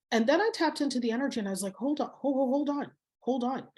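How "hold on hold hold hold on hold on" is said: higher-pitched, halting double take; mildly startled, repeating it in a higher voice; retold taken aback. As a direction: act out putting the brakes on, pitched up. Retold startled reaction to aggressive energy.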